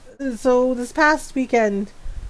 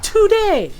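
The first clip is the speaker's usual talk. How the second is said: higher, louder, fast exasperated retort; mock-annoyed, pitched-up and quick; 8 semitones higher, 4 dB louder than usual. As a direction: rattle it off in frustration. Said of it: "Yes, I'm like".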